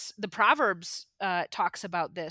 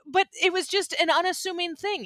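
normal talking voice

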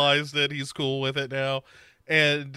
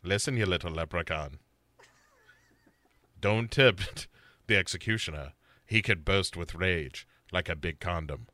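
very low, soft, menacing voice; imitating serial killer; 10 semitones lower, 6 dB quieter than usual. Killer warning the little leprechaun, very low menacing voice. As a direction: threaten quietly from down low, with long pauses. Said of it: "he's like".

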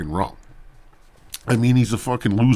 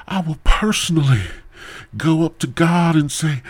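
mock TV evangelist; 8 semitones higher, 3 dB louder than usual. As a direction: parody a televangelist, stretching words and pausing for effect. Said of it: "T V evangelists".

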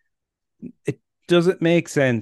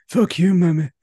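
high, loud mock Irish outburst; Irish accent; 7 semitones higher, 5 dB louder than usual. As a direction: spit it out in a broad Irish accent. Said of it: "that was more Irish than".

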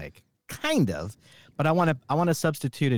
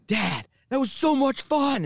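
put-on much higher, bubbly kid voice; excited kid; 13 semitones higher than usual. The kid bouncing in the car with his dad, high and giddy. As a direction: bounce off the walls with joy. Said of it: "he's bouncing off the walls".

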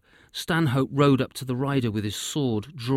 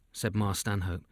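plain reading voice

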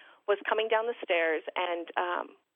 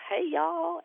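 higher, mock Southern "Hey, y'all"; 9 semitones higher than usual. Mother imitating the doctor's folksy Southern greeting.

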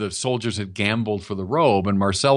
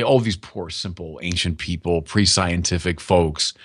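lightly mimicked pitying condescension, pitched lower; mock condescension; 7 semitones lower than usual. Preacher imitating people who look down on pre-scientific folks, mildly condescending. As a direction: talk down in a low, dismissive mock-quote. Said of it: "That is pure condescension".

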